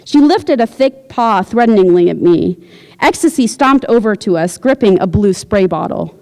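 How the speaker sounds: usual speaking voice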